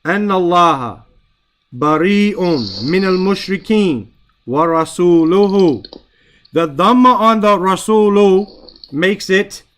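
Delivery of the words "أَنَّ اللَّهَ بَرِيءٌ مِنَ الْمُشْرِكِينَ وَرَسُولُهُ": Quran recitation. Acted. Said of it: "It says".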